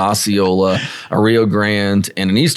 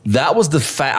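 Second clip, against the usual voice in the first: usual speaking voice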